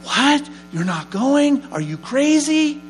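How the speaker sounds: high, loud incredulous cry; shocked disbelief. Retold or imitated. Host mother shocked he won't go.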